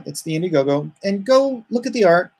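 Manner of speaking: ordinary delivery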